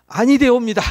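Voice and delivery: 아니 되옵니다 blurted out loud, with a falling stress on 아니; preacher exclaims the warning loudly; emphatic loud. Shown as a demonstration. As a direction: declare 아니 되옵니다 forcefully, in mock-archaic tone.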